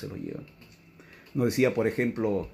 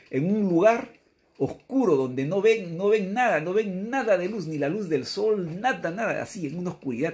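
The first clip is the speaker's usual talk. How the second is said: urgent imagining; 8 semitones higher, 3 dB louder than usual. Acted. Urging listeners to imagine darkness.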